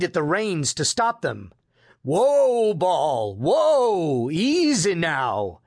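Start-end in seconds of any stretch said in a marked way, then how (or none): 2.0-5.6 drawn-out calls to runaway horses; firm command. A narrator's reading. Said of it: "he commanded firmly".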